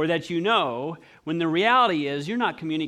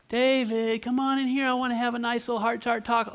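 lightly acted, higher-pitched coaxing; devil's coaxing invitation; 5 semitones higher than usual. Devil luring someone in for a talk, coaxing. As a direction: coax them in with a slightly raised voice. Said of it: "would I be like".

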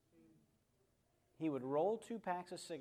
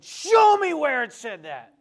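slow, much higher and louder challenge; 12 semitones higher, 20 dB louder, slower than usual. Retold or imitated. Pastor challenging Joe, slow loud demand.

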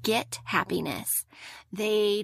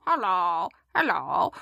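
low mechanical robot greeting; 4 semitones lower than usual. Retold or imitated. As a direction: say it like a toy robot. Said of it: "the robot goes".

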